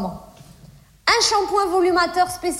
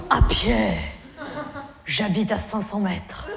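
low, deep mock male voice; Jean-Loup's voice; 8 semitones lower, 5 dB quieter, slower than usual. Jean-Loup wrongly thinks she likes it, in a low mock-male voice. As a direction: purr it like a smug suitor.

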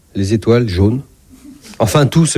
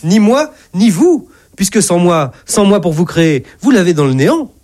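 higher-pitched, emphatic retort; indignant correction; 7 semitones higher than usual. Acted. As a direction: hammer each point home to the doctor. God heatedly corrects the doctor.

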